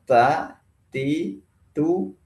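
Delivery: TA, TI, TU with a light puff of air; breath-releasing ta. Shown as a demonstration. Teacher proving airflow on the palm, lightly aspirated t. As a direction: let a little breath follow each t in TA, TI, TU. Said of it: "itu ada nafas menyentuh telapak tangan".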